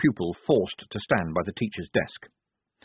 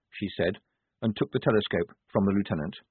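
ordinary delivery

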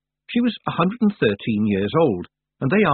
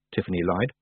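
ordinary delivery

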